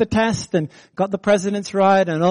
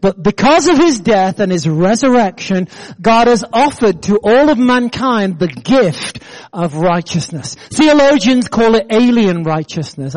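normal talking voice

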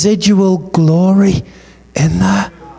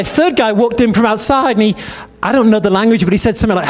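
ordinary talk, nothing marked

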